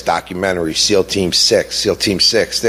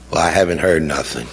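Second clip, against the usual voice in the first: ordinary talk, nothing marked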